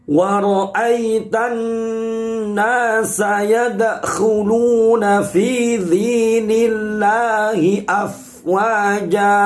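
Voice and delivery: slow, drawn-out chanted Quran recitation. Shown as a demonstration. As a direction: recite the verse slowly, melodically. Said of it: "Baik, saya ulangi". (tilawah Al-Quran yang lambat dan berirama)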